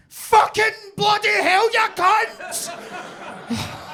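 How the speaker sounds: high, slow, drawn-out scream; full-force acted howl of pain; agonised swearing shout. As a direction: bellow each word in sudden agony. Acts out pained swearing in taxi.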